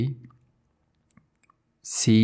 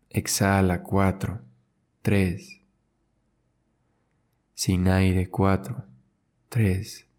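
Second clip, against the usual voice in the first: slow breath counting. Acted. Slow counted breathing with long pauses.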